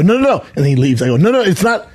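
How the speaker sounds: high, pleading "no, no, no"; rushed high protest to a leaving fan; urgent protest. Retold or imitated.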